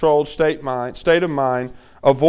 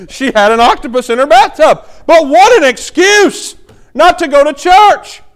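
louder, higher, slowed-down disbelief; incredulous; 9 semitones higher, 9 dB louder than usual. Acted. Pastor marvelling at a churchgoer's excuse, loud and incredulous. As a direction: exclaim it like it's outrageous. Punchline of the octopus story.

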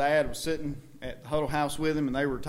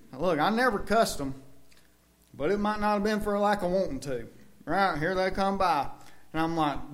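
ordinary talk

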